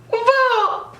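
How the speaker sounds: high-pitched drawn-out awe; amazed. Retold or imitated. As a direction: let out a high, long wow. Amazed reaction in retold talk.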